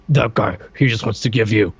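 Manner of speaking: imitating a mumbling cartoon tough guy; mumbled Sergeant Savage. Co-host mimics the sergeant warning the kid, mumbled impression. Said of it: "this guy mumbles so much".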